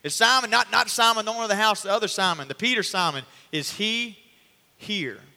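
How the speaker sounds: yelling. Retold or imitated. Soldiers at the door yelling for Simon Peter, loud and high. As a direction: shout up to the roof for Simon. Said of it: "And they start yelling".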